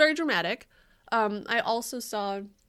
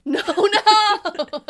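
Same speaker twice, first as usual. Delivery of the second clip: high, loud, laughing protest; mock wail; 12 semitones higher, 13 dB louder than usual. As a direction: wail it in play, through laughter. Mock protest at being called stupid.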